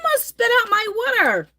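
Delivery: high-pitched, helpless real laughter through the words; laughing. Real, not acted. Streamer reacting to a chat joke that nearly made them spit, laughing. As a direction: squeal it out, cracking up. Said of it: "that made me laugh".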